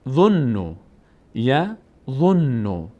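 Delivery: یظنو stretched, the doubled n held; very slow demo. Shown as a demonstration. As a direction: draw یظنو out slowly. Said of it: "یک بارم با ضمهٔ خودش". («یظنو» بسیار کشیده، نون مشدد بلند)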